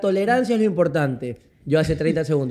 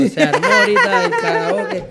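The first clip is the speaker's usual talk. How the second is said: laughing. Real, not acted. Laughing retelling of plate mishap.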